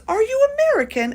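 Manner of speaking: high-pitched, loud mock English accent; horrible English accent. London kid asking a traveler at the airport, horrible English accent. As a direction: imitate an eager English kid, badly. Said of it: "bring out a horrible English accent".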